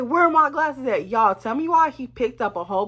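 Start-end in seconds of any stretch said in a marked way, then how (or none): 0.0-1.1 angry. Retold or imitated. Demanding her glasses.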